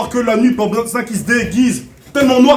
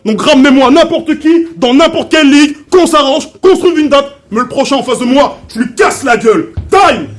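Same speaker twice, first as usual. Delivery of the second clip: loud, higher battle rap climax; shouting; 4 semitones higher, 5 dB louder than usual. Real, not acted. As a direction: build to a shout.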